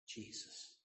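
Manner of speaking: a dying woman's faint whisper; soft whisper of the name; hushed whisper. Retold or imitated. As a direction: whisper the name, barely a breath. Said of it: "dying woman answering nurse".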